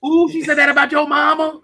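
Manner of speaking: high, put-on goading voice; mock instigating. Comic playing the pre-fight instigator, mock scandalised goading. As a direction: stir up trouble with a high teasing ooh.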